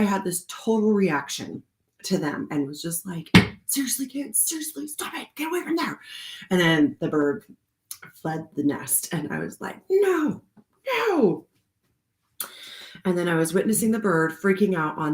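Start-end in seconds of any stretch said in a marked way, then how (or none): 3.7-6.0 high, softened exasperated pleading; mock telling-off, pitched up but held back in volume; retold scolding. Retelling scolding reaction to kids. 9.9-11.5 high, wailed protest; pitched-up lament, retold; mock dismayed cry. Retelling dismay as bird flees.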